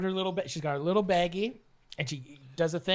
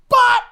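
high, explosive imitated smack; punch sound effect; 13 dB louder than usual. Guy dropping the sister, loud punch sound effect. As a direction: fire off a loud comic impact noise.

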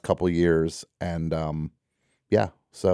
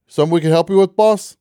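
higher and louder, a bright clerk greeting, ending way up on boss; eager store clerk; 8 semitones higher, 5 dB louder, faster than usual. Retold or imitated. Clerk calls the host boss, higher and louder. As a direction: sound like a clerk fawning over a customer. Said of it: "This guy walks into a place and just like".

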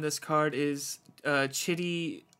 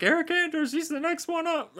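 hyped announcer; 12 semitones higher than usual. Retold or imitated. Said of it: "they were like".

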